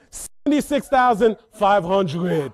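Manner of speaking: put-on slow, loud bid in Haitian accent; calm, accented bid. Mom raises by five hundred, deliberate Haitian-accented bid. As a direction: imitate mom's Haitian accent, stretching the number. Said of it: "calm, collect".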